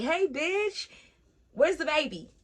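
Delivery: high-pitched mock phone hello; chirpy friend greeting. Best friend calls about the baby, high chirpy imitation.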